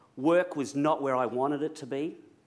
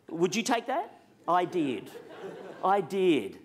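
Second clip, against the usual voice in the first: ordinary talk, nothing marked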